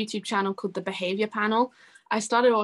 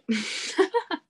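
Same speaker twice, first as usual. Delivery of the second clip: quick high giggle; fond laugh; 8 semitones higher than usual. Real, not acted. Singer laughs about winding up her dog, giggling. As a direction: laugh fondly at the thought.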